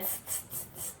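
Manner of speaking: unvoiced tss tss tss, copying; mock the peddler with a breathy tsk; whispered monkey tsk. A narrator's reading. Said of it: "at him and said".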